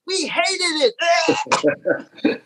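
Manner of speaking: slow drawn-out high complaint; slow mock groan. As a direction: drag it out in mock disgust. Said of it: "They were like".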